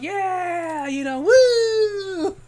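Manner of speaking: high, loud drawn-out woo; stretched concert whoop; crowd cheer. Retold or imitated. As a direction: cheer back at the stage like a fan. Crowd cheering back at the singer.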